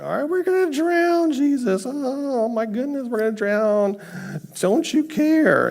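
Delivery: hammed-up panic, very high-pitched; freaking out. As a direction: wail like terrified men in mock despair. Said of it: "the disciples are all freaking out".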